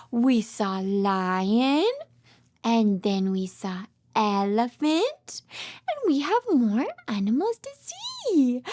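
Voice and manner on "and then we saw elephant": usual speaking voice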